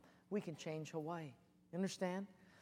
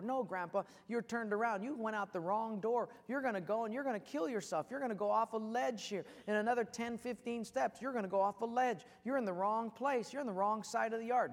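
higher, insistent pleading; urgent warning; 6 semitones higher, 4 dB louder than usual. Acted. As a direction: warn him off the edge. Grandchild urgently warns blind grandfather.

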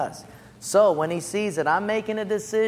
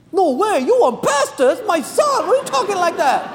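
very high, loud cry of disbelief; hammed-up maternal outrage; mock indignant mother; 13 semitones higher, 7 dB louder than usual. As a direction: play an appalled mother protesting. Retold mother protests to pastor.

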